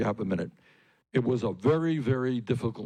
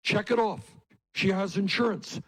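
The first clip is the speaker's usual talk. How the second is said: higher, dismissive mock politician voice; 6 semitones higher than usual. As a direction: toss it off like a smug politician brushing you aside. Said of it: "politicians will say".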